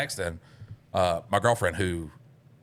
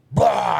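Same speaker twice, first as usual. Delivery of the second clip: loud, high mock vomit noise; retching noise; 11 semitones higher, 9 dB louder than usual. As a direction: imitate water heaving up suddenly. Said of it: "I throw up water".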